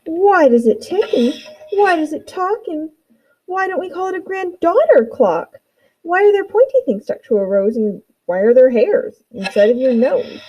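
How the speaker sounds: lightly voiced curious little girl; a hint of a wondering child's voice; curious child asking. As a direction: ask why like an eager small child. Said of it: "she simply asked".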